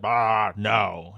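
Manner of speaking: loud, blurted comic denial; mock loud refusal. Reader imagining Loki refusing Luffy's question, mock loud refusal. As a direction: burst out with a playful refusal. Predicting Loki's reply in role.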